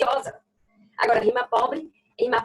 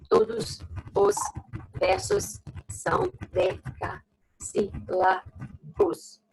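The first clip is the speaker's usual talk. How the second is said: slow emphatic dictation; 7 semitones higher, much slower than usual. Shown as a demonstration. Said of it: "Vai só até a última sílaba tônica".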